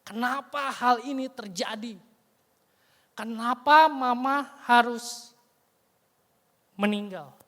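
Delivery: loud grieving cry, voice climbing; anguished shout. Retold or imitated. Locked in his room, son screams out his grief. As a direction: shout the why at the sky. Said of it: "dan dia teriak".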